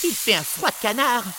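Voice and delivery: high, loud shivering elf; a cold little elf complaining; christmas elf. A narrator's reading. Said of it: "dit le lutin".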